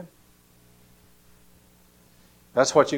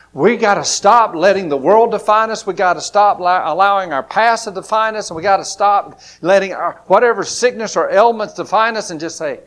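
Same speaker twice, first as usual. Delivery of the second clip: preaching; 4 semitones higher, 9 dB louder than usual. Real, not acted. Preaching climax.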